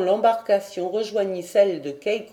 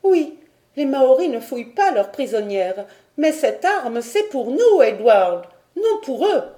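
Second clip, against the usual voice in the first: much higher and louder, rising to a cry; impassioned plea; 5 semitones higher, 6 dB louder than usual. Acted. Lady Helena insists the weapon is for the women, rising to a high loud cry. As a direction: press it on him with mounting urgency. Helena pleads over the revolver.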